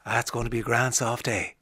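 quietly lilting Irish forecast; Irish Alexa. Acted. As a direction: give the forecast as a low-key Irish voice assistant. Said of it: "delivered in an Irish voice".

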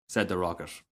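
plain reading voice

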